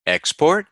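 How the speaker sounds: EXPORT as ex-PORT, rising high on PORT; high rising finish on EXPORT; second-syllable stress. Shown as a demonstration. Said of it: "the second syllable is stressed".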